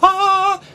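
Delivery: high, loud excited squeal; excited shriek. Real, not acted. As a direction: squeal with glee at the news. Excited cry at video news.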